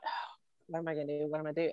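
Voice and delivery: soft, hurried inner voice; worried self-talk. Retold or imitated. Dog owner wondering how to handle husband, worried self-talk. Acts out her worried thought.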